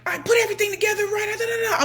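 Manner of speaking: frantic high fast babble; frantic rushed squeaky recap; panicked self. Retold or imitated. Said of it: "I'm like".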